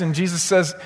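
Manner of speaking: ordinary talk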